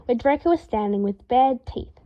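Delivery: ordinary delivery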